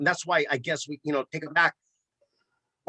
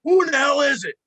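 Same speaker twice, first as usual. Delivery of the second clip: much higher and louder, a retold yell; gruff shout; 12 semitones higher, 7 dB louder than usual. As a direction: bark it through the door like a grumpy old vet. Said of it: "He hears this gruffer voice".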